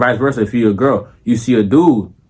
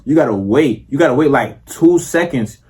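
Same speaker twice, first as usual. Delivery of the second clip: normal talking voice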